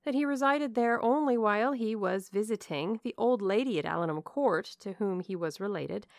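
ordinary delivery

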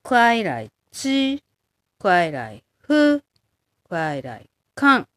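快来チ, 快来フ, 快来カン each said slowly and carefully in Mandarin; slow Mandarin review. Shown as a demonstration. Teacher reviewing the three phrases for learners, slow. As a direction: drill the three phrases syllable by syllable. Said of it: "review drill".

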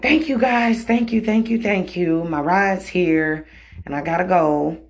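high, gushing retold thank-yous; effusive thanks. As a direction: pour out thanks, starting high and easing down. Acting out hurried thanks before leaving.

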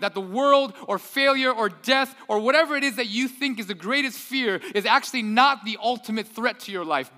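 fervent pulpit climax; preaching climax. Acted.

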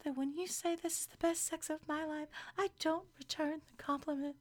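high, soft whisper-voice impression; Giannina. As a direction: mimic a hushed, high-voiced put-down. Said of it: "you mean whisper voice?".

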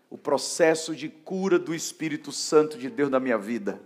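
loud climactic sermon voice; preaching at full force. Acted. Preacher urging the church to let go of hurt, loud preaching. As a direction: drive it home to the congregation.

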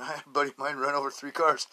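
normal talking voice

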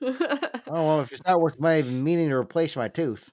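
lightly hammed-up character voice, starting high; old-timer finder. Finder hears the bullet is worthless, mock role voice. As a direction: play the disappointed finder, tongue in cheek.

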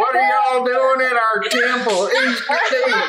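put-on laughing as a guard with a Southern accent. GM jokingly voices a guard in a Southern accent. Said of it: "I'll have a Southern accent now".

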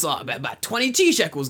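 name the price in a put-on voice; hammed-up offhand quote, slightly high; casual mock seller. Acts out seller naming price.

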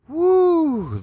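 loud, very high whoop; excited whoop. Real, not acted. Host cheering himself after his rant, loud whoop. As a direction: let out a loud cheer of release. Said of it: "Got me all fired up".

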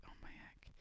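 hushed shocked whisper. Retold or imitated. Whispering to husband in shock at message. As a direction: breathe it out in disbelief. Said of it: "so I'm like".

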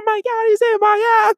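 high, loud mock panic; mock panicked pilot. Imagined pilot in a dogfight, hammed-up panicked yell. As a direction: yell like a pilot with an enemy on his tail.

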